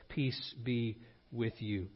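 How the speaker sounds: very soft, slow and low; a hushed, unhurried greeting of peace; gentle blessing. Acted. Voicing Jesus greeting the disciples.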